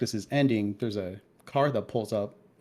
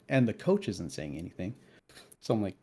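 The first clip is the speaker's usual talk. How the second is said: ordinary talk, nothing marked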